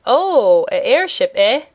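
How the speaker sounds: drawn-out surprised "oh", swooping pitch; a sailor's mild amazement; surprised cry. A narrator's reading. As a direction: react with surprise, ending on a questioning "eh?". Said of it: "cried Cap'n Bill in surprise".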